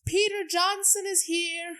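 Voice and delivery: high-pitched mock yell, held back in volume. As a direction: call it out high without real shouting.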